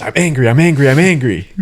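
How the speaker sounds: dad yelling while jumping; mock angry shout. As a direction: playfully shout your anger out. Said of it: "He goes".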